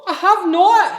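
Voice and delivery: high, loud protest; indignant denial. Acted. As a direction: yell back in denial. Children quarrel over the river.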